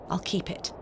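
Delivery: whispered aside to herself. Acted. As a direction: breathe it under your breath. Aside while pocketing hairpin.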